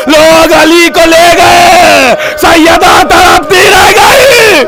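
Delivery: high, loud, slow keening preaching; wailing sermon lament. Acted. Preacher to grieving mourners, wailing high and slow. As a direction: cry it out at full force to the mourners.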